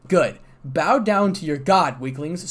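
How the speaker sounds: lightly voiced, haughty command; arrogant command. A narrator's reading. Dragon lord Clan ordering the dragons to bow, lightly haughty. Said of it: "arrogance and pride".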